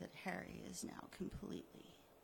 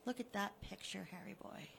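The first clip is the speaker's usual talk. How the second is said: usual speaking voice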